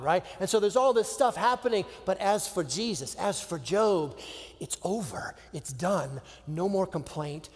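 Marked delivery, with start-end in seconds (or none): none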